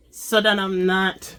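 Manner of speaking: talking through a brain freeze; strained words mid brain freeze. Real, not acted. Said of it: "I got a brain freeze".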